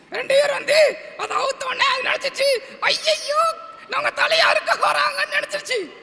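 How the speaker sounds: high-pitched puppet storyteller; டிங்கன். Pretend. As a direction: tell a tale in a tiny squeaky character voice. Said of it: "ஒரு கதை சொல்லணும்னு". (கதை சொல்லும் கீச்சுப் பொம்மைக் குரல்)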